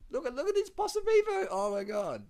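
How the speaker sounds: very high, loud, laughing disbelief; incredulous shrieking. Real, not acted. Watching the rider zigzag, commentator shrieks with laughter. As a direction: shriek at the screen, laughing helplessly.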